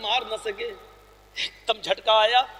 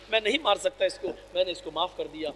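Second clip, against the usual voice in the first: much softer, lower and gentle; tender forgiveness; 4 semitones lower, 7 dB quieter than usual. Acted. The Prophet telling Aisha he forgave the girl, soft and tender. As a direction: let it sink into quiet mercy.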